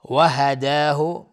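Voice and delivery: "و هداه" slow and loud, long aa; natural madd. Shown as a demonstration. Teacher shows the vowel length, "و هداه" loudly drawn out. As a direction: hold the aa of "و هداه" evenly. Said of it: "مد طبیعی است".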